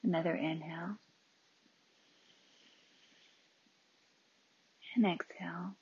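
calm breathing cues with long silences; unhurried meditation leader; guided meditation. Real, not acted. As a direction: guide each breath, leaving room after it.

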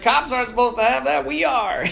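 high, smug rookie voice; cocky player. Retold or imitated. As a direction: brag like a careless freshman. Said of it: "He's like".

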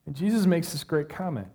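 normal talking voice

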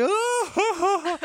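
high, loud mock crowd gasp; crowd's reaction. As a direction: react like a whole stadium at once. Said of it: "everybody's like".

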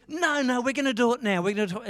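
high, loud eager insistence; insistent friend. Retold or imitated. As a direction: push back excitedly as the impatient mate. Eager friend refuses to wait.

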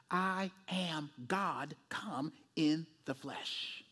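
very slow, low, soft, word by word; solemn declaration. Acted. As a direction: let each word land with hushed weight. Solemnly voices Jesus' central claim.

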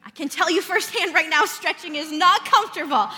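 playful emphatic confession; joking. Real, not acted. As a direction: exclaim it as a joke, high and loud. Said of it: "all joking aside".

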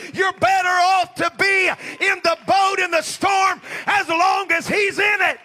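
loud, high, building shout of a preacher; preaching at full force. Acted. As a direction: build the sermon to a roaring climax. Preaching climax on the boat.

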